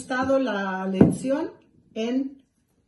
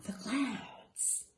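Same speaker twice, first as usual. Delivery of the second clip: hushed gasp; 10 dB quieter than usual. Acted. Gasps at the clouds picture.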